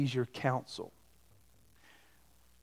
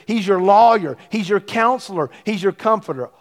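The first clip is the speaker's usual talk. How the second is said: emphatic preaching; 4 semitones higher, 8 dB louder than usual. Real, not acted. Preacher stressing key title.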